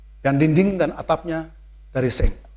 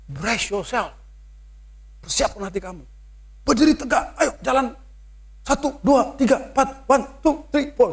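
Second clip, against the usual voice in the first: higher, barked drill-sergeant counting; mock drill commands; 5 semitones higher than usual. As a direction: order him around like a drill instructor. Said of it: "Seolah-olah mereka menakut-nakutin". (suara komando mengejek)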